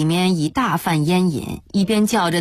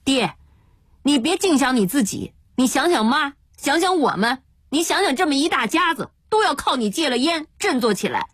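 higher-pitched, insistent pleading; urgent remonstrance; 8 semitones higher than usual. Acted. Younger son cutting off father's cries, urgent exhortation. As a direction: reason with him urgently, pitch raised.

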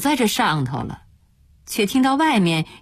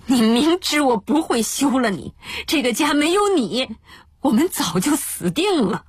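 higher-pitched, exasperated outburst; agitated, stamping protest; 7 semitones higher than usual. Acted. Husband stamping behind the door at his wife, agitated. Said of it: "天罪便在里面顿脚".

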